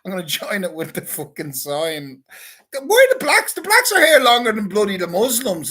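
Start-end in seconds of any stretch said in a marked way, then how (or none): 2.7-5.7 loud and much higher, worked up; high-pitched loud protest; heated outburst. Real, not acted. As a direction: blurt it out in a mock indignant rant. Heated rant about the blacks.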